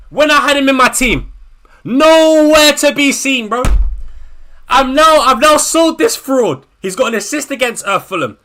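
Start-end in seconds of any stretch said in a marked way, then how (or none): 0.1-6.7 loud bitter complaint; real fury at the player; angry yelling. Real, not acted. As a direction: keep shouting in disbelief. Continues shouting rant.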